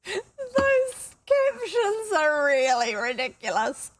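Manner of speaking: high, slow squeal through real laughter; helpless laughter. Real, not acted. Reader cracking up at the captions, squealing with laughter. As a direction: barely get the words out for laughing.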